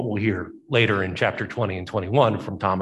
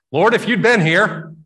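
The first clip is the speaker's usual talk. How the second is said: reproachful Martha; 8 semitones higher, 5 dB louder than usual. Acted. Grieving Martha reproaches Jesus.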